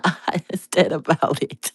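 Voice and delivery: breathy whisper with a chuckle; whispering through a giggle; whispered aside. Real, not acted. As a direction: drop to a whisper, half laughing.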